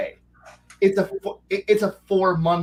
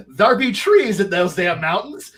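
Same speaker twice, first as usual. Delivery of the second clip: louder, hammed-up rustic declaration; mock druid proclamation; 3 semitones higher, 5 dB louder than usual. Druid circle leaving for the mountains, hammed-up louder proclamation. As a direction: announce it like a druid done with the old world. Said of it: "a druid circle just picking up and going".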